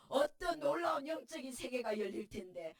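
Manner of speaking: ordinary delivery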